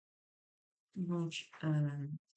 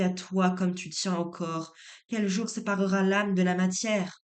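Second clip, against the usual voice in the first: poème déclamé, de plus en plus fort; lecture de poème; 6 dB louder than usual. Acted. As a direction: lis les vers posément, puis déclame avec ferveur croissante. Lecture du poème à voix haute. (lecture de poésie déclamée, montant en intensité)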